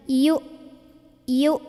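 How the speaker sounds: short "yu" stopped dead by a glottal catch; pressed, held hamzah. Shown as a demonstration. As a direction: say "yu" and lock the throat, nothing after. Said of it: "habis itu tidak ada bunyi lain".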